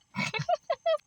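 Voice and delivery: a high, embarrassed giggle at her own bad Irish accent; high laugh. Real, not acted.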